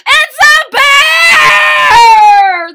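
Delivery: very high, very loud, slow scream; terrified shout. Acted. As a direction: scream at the bear in terror. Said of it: "they all shouted".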